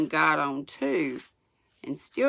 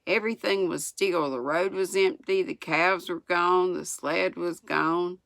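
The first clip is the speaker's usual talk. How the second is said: usual speaking voice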